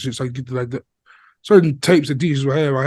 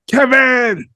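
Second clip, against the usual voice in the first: shout; 10 semitones higher, 5 dB louder than usual. Pretend. Home Alone mom calling Kevin.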